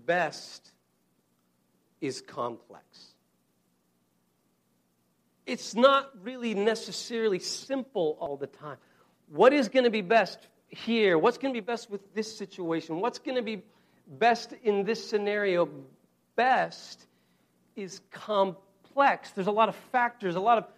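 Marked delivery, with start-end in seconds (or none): 0.1-3.1 slow, low and weighty, BEST then is complex after a pause; slow emphatic repeat. Shown as a demonstration. Preacher to congregation, slow weighty emphasis. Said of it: "here's the controversial point I wanna suggest". 16.4-19.3 Best held alone, then is complex drawn out slowly; slowed emphasis. Shown as a demonstration. Preacher hammering home his point, slow emphasis.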